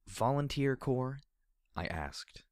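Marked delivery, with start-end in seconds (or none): 0.1-1.2 slow, high questioning echo; puzzled question. Acted. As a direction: ask it back, puzzled. Puzzled question echoing her words.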